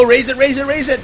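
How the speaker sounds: rising excited urging. Real, not acted. Urging player to raise depot.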